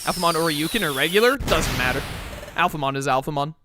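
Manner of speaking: usual speaking voice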